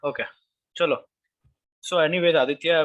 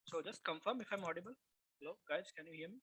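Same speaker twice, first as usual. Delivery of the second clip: faint, distant mic sound; barely audible; 3 semitones higher, 20 dB quieter, faster than usual. Real, not acted. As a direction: voice far too low on the mic. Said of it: "your voice is low".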